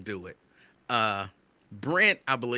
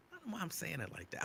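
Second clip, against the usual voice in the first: ordinary talk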